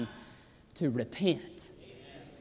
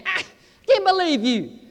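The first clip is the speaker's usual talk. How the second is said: higher, louder scoffing jeer; mocking scoffer; 9 semitones higher, 5 dB louder than usual. Preacher voicing the scoffers who laugh at Paul, mocking. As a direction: mimic a jeering Stoic sneering. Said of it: "they're laughing at him".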